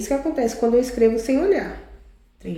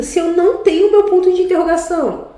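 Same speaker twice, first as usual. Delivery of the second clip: higher, louder mock-desperate student question; desespero encenado; 6 semitones higher, 5 dB louder than usual. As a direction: plead with the teacher in mock despair. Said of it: "Sem desespero, sem puxar os cabelos". (desespero fingido de aluno, voz mais aguda e alta)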